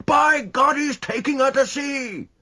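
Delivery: high, loud outraged cry; shouting. A narrator's reading. Rashleigh shouting as his ship escapes, outraged. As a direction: roar it in disbelief. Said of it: "shouted Rashleigh".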